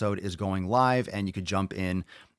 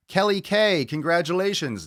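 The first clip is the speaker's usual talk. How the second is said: high, loud congratulations; big, raised-pitch shout-out; winner announcement; 9 semitones higher, 5 dB louder than usual. Real, not acted.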